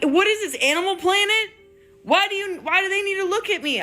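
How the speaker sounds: high, incredulous squeal. Retold or imitated. High disbelief as her friend says turn around. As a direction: act baffled at being looked at. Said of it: "I'm like".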